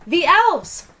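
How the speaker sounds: high, eager cry; thrilled to spot them; a child's high excited call. A narrator's reading. Child shouting at elves.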